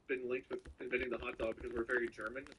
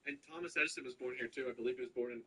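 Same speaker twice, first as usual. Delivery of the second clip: ordinary talk, nothing marked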